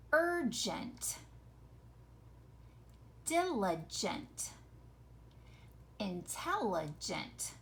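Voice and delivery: URGENT, DILIGENT, INTELLIGENT spaced out with a clear soft J; enunciated for listening. Shown as a demonstration. Teacher has pupils listen for the J sound, enunciated. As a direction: read Urgent, diligent, intelligent one careful word at a time. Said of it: "I want you to listen".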